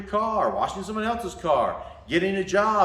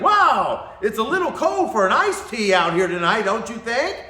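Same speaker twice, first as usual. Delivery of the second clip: higher, louder friendly small talk; chatty cashier; 5 semitones higher, 7 dB louder than usual. Retold or imitated. Imitating the chatty cashier's small talk, a touch higher and louder. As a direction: chat him up like a cheerful worker. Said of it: "he's like".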